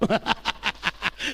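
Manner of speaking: short burst of chuckling; amused cackle; laugh. Real, not acted. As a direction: laugh at your own punchline. Laughs at own story.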